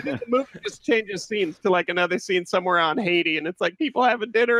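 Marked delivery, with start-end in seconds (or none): none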